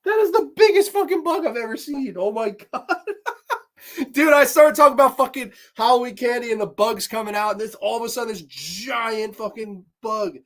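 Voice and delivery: high, loud, laughing disbelief; rattled and laughing at the bug; freaked-out rant about a giant bug. Real, not acted. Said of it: "That was fucking terrifying".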